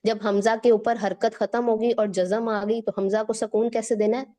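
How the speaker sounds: ordinary talk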